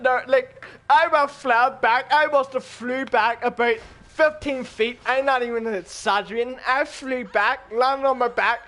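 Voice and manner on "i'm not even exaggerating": usual speaking voice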